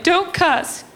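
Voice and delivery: high scolding mom impression; put-on high reproving mom voice; mom's voice. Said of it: "she was like".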